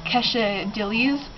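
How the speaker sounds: quesadillas garbled slowly as "kesha-DILL-eez"; botched pronunciation. Shown as a demonstration. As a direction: draw out quesadillas as "kesha-dillies", l sounded. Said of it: "I said, like".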